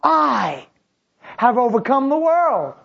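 high and loud proclamation; triumphant declaration. Retold or imitated. Jesus declaring victory to his disciples, loud and high. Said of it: "Jesus said".

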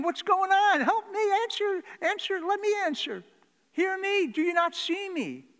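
high, loud, pleading; desperate pleading cry. Retold or imitated. Preacher voicing an ignored child, loud desperate plea. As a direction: beg to be seen, loud and high.

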